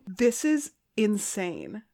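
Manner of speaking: ordinary delivery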